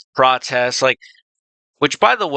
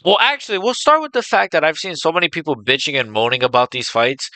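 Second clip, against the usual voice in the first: normal talking voice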